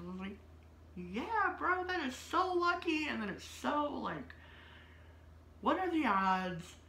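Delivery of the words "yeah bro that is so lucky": mock sarcastic. Sarcastic reply to doctor.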